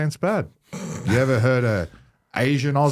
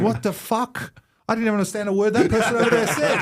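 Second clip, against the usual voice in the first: amazed disbelief; 10 semitones higher than usual. Real, not acted. Disbelief at the accent.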